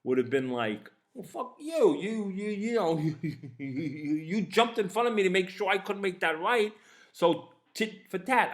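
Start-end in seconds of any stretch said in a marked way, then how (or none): 1.1-8.5 heated, higher-pitched mock comeback; hot-headed. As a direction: fire back like your old hothead self. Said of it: "the hot-headed me".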